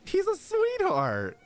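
high, slow, doting coo over a cute character; gushing coo. Real, not acted. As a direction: melt over him like he's adorable.

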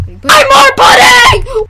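high, loud, drawn-out shout; hammed-up sales yell. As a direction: yell it as the big ad slogan. Mock commercial climax.

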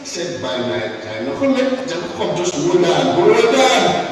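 high, loud, fervent preaching; a rising shout of faith over the music; full-force preaching. Acted. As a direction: preach it out to the whole church.

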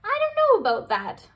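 high, loud, fast reply; the crab cheering up the moth, high, loud, fast; brisk cheerful crab. Acted. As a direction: perk up and rush to encourage her.

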